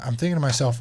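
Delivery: normal talking voice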